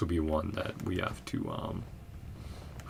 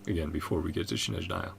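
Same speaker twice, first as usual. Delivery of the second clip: ordinary delivery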